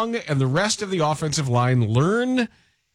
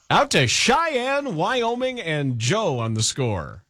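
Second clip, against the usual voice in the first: drawn-out announcer; 5 semitones higher, much slower than usual. Acted.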